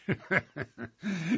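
a menacing, forced short laugh; false laugh. Acted. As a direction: fake laugh with threat behind it. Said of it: "loosened into a false laugh".

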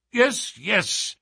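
high-pitched impatient interruption; impatient cry. A narrator's reading. Duke cuts short Holmes's questions about the reward, impatient. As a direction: cut in impatiently, pitched well up. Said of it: "cried the Duke impatiently".

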